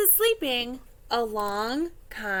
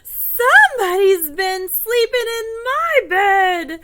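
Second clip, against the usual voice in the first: high, loud, slow indignant bear; Papa Bear; 8 semitones higher, 9 dB louder, slower than usual. Acted. Seeing his bed slept in, loud astonished father bear. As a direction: boom it out slowly in astonishment. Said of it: "Papa Bear looks at his bed and he says".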